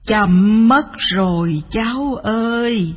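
very slow, drawn-out farewell; sorrowful grandmother bird. Acted. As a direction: stretch the words like a departing bird.

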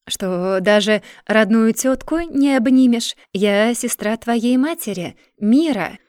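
loud, reproachful aunt. Acted. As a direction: scold her with hurt reproach. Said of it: "с укором проговорила женщина".

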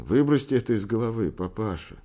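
loud, high-pitched protest; vehement, impassioned rebuttal; heated outburst. A narrator's reading. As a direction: argue it at full voice, escalating. Son hotly argues with father.

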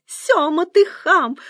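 high, loud outraged scolding; indignant cry. A narrator's reading. Eavesdropping wife scolds her husband, indignant cry. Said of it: "возмущённо вскричала Циля".

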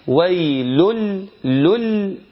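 "ويل لل" chanted as "waylul-lul", L doubled; no N at all. Shown as a demonstration. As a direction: tanween of "ويل" turned into a clear L. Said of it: "ما حدش فيه نون أصلًا". (نطق "ويل لل" بلام مشددة دون نون)

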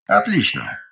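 low pleased "Отлично". Acted. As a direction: approve it in a low voice. Ignat approves the report. (низко и довольно)

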